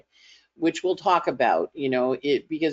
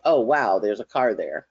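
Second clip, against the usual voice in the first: mild mock surprise; 5 semitones lower than usual. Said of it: "you're kinda like".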